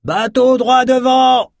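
loud, high, drawn-out warning call; stretched high-pitched yell; lookout's shout. A narrator's reading. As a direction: holler it like a ship's lookout. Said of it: "cria soudain la vigie du cargo".

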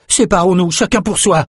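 high, urgent shout to scatter; panicked cry. A narrator's reading. Brock tells the fugitives to split up, crying out. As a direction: call it out in panic. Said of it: "cria Brock".

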